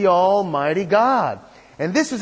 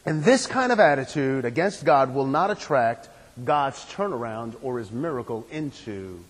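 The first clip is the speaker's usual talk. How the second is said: usual speaking voice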